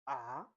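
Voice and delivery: Mandarin second tone on AH, sliding upward. Shown as a demonstration. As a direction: let AH climb like a question. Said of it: "this is the second tone".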